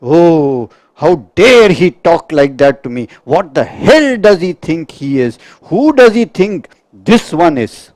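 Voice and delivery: loud, indignant outburst of a wounded ego; offended ego. Acted. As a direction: bristle with outrage, how dare he. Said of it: "This I".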